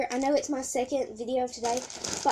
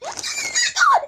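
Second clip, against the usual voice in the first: high squealing shout of real delight; 5 dB louder, slower than usual. Real, not acted. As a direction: squeal with sudden joy at the package. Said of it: "Sorry if I squeal a lot".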